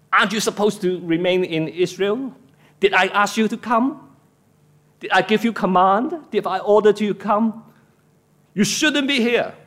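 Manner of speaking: acted interrogation, climbing in pitch to the verdict; stern rebuke. Preacher as God questioning a prophet who fled, stern rebuke. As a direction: press each question as a reprimand. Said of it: "I will rebuke him".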